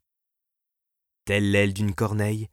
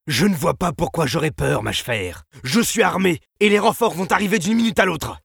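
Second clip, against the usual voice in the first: high, loud, rattled protest; frightened bravado, rising in pitch and volume; panicky bluster; 9 semitones higher, 8 dB louder than usual. A narrator's reading. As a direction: shout down your own fear. Trembling director insists he is unafraid.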